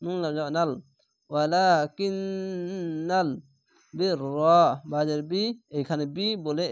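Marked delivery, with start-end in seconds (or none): 1.3-3.3 ওয়ালাকিন্নাল with the ন্ন held long and humming; nasal ghunna. Shown as a demonstration. Teacher showing compulsory ghunna, slow and chanted. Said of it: "ওয়াজিব গুন্না".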